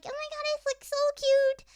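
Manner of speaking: high-pitched cooing delight; gushing. Retold or imitated. Recalling a love triangle anime, gushing. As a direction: squeal lightly at how adorable it is. Gushing over a cute show.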